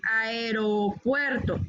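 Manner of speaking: "aeropuerto" slowly, a-e-ro, then puerto; slow teacher's model of "aeropuerto"; careful aeropuerto. Shown as a demonstration. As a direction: break "aeropuerto" into clear slow parts. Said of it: "la forma es aeropuerto".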